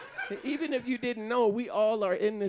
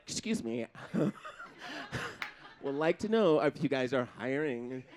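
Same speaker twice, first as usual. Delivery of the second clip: soft, polite, lower job-seeking voice; 7 semitones lower, 4 dB quieter than usual. Pretend. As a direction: sound as harmless as you can on the phone.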